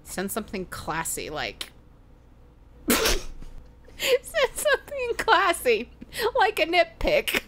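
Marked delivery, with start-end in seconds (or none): 2.4-7.4 high, slow, laughing through the words; cracking up at her own joke. Real, not acted. Laughing at her own advice to viewers. Joking advice to dick pic senders.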